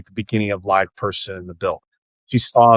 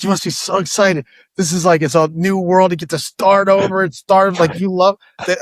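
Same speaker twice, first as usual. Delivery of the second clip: mock excited wife; 7 semitones higher than usual. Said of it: "She's like".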